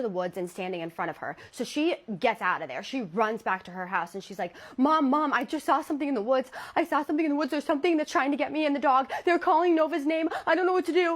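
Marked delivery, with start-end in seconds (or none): 4.8-11.1 higher, louder frantic rush; panicked child. Retold or imitated. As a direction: babble in fear to mom. Scared child running to mom.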